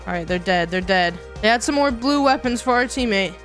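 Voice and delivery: ordinary delivery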